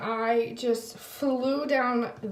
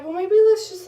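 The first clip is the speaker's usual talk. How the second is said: pleading; 10 semitones higher, 8 dB louder than usual. Retold or imitated. Pleading for one more ride.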